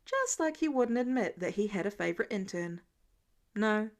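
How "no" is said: ordinary delivery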